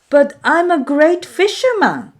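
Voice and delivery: put-on high, loud young Maui insisting. Maui insists to his doubting brothers, high and loud. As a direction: stand your ground, boy. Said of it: "Maui insisted".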